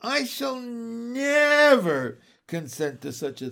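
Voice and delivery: high, loud wail stretched on never; moaned protest. A narrator's reading. Miss Lark refusing Andrew's demand, moaned protest. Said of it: "moaned Miss Lark".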